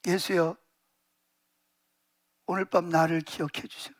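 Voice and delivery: low, soft entreaty; humble plea. Acted. Penitent robber asking Jesus to remember him, humbly pleading. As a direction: plead quietly, voice dropped low.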